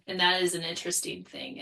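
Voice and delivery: usual speaking voice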